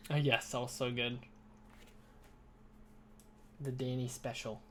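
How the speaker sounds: talking while chewing gum. Real, not acted. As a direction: chew gum between words. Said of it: "I need to stop chewing my gum".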